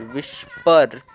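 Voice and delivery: "विस्पर्स" said slowly, with no h sound after w; slow pronunciation demo. Shown as a demonstration. Teacher showing that "विस्पर्स" has no h sound, slowly. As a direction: say "विस्पर्स" slowly, starting straight on "वि". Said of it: "और फिर पर".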